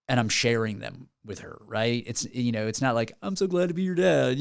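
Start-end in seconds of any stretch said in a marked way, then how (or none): 3.2-4.4 higher-pitched, hammed-up sappy line; mock cheesy. Songwriter parodying a cheesy dad song, mock sappy.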